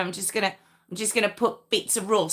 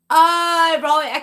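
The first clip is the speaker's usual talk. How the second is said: surprised; 9 semitones higher, 8 dB louder than usual. Real, not acted. Realises chat's meaning.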